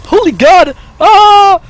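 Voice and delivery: loud excited yelp, high; excited shout. Real, not acted. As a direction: shout out with sudden joy. Doorbell, excited for new computer.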